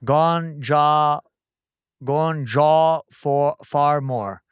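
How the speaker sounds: drawn-out, separated syllables; very slow. Real, not acted. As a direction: sound it out piece by piece, slowly. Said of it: "Try, try to say it slower".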